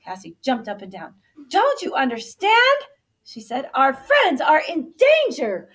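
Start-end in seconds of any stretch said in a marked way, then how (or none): 1.5-2.8 high, loud, insistent question; exasperated urging. A narrator's reading. Cassie jumping, urging the others. 3.7-5.7 loud, high, drawn-out alarm; urgent warning. A narrator's reading. Cassie urging a rescue.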